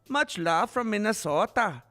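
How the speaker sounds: put-on Minnesota accent. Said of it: "I love that accent".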